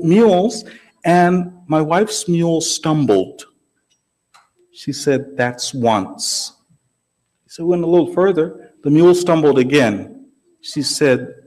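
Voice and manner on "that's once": low, soft mock warning; understated, measured retold threat; quiet warning. Wife to the stumbling mule, a quiet warning. Wife's terse warning in joke.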